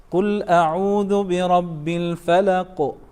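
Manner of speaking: measured chant, echoed q closing الفلق; emphasized qalqala. Shown as a demonstration. Teacher modelling the correction, emphasized echo at the end of الفلق. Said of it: "with more emphasis".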